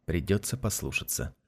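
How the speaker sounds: ordinary delivery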